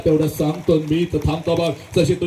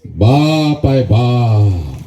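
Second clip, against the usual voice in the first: loud, deep, drawn-out declaration; weighty proclamation; 8 semitones lower, 5 dB louder, much slower than usual. Real, not acted. Speaker names the father at the climax, deep loud proclamation. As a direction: boom it out low and solemn.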